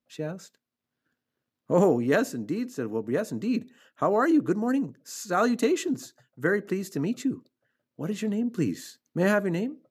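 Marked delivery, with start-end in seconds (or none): 1.7-2.7 high, eager agreement; thrilled piglet greeting; delighted. A narrator's reading. Wilbur delighted to see his friend. 3.1-9.8 high, gushing piglet greetings; thrilled, over-polite introductions; excited. A narrator's reading. Wilbur gushes greetings to new friend.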